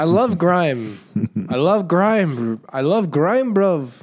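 slow, high, loud attempt at an English accent that comes out Irish; drawn-out English accent that lands Irish; failed English accent. Joking about grime music, loud botched English accent. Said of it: "that's Irish".

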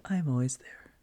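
eerie hushed spirit voice; ghostly whisper. Retold or imitated. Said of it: "They said".

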